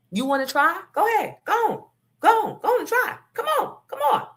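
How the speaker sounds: high, taunting mother daring him; mocking taunt. Mother taunts the father, a high mocking dare. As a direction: dare him to try it himself.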